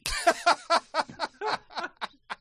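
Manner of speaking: loud, high burst of laughter; real laughter; big laugh. Real, not acted. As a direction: crack up at yourself. Laughs at own story.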